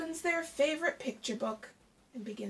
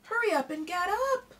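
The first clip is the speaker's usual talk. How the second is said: higher, louder nagging cry; impatient hurry-up call; 8 semitones higher, 7 dB louder than usual. Acted. Hare hurrying Tortoise out of bed, loud and impatient. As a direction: rush him out of bed.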